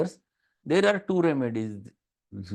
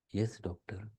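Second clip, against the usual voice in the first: much softer, meek answer of a timid patient; meek patient reply; 4 semitones lower, 11 dB quieter than usual. Retold or imitated. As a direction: murmur it meekly, like a mild patient answering the doctor. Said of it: "They talks like this".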